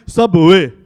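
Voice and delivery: booming Arabic-accented SUBWAY, drawn into three syllables. Shown as a demonstration. Comic shows how Dubai says Subway, loud Arabic accent. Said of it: "over there".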